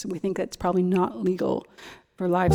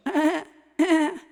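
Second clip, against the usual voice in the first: higher imitated goat bleat; 8 semitones higher than usual. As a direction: bleat back like the goat Heidi. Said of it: "and she'd go".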